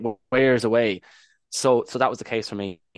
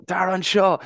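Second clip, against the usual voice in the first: put-on high, louder call to a child; raised parent's call in Irish; 9 semitones higher, 4 dB louder, slower than usual. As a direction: call a child over in the supermarket. Acts calling kids in supermarket.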